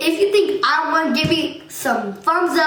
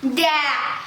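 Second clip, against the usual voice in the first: ordinary talk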